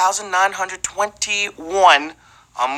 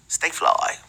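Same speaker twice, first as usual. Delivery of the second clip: whisper; 6 semitones lower, 6 dB quieter than usual. Acted. Whispered sign-off aside.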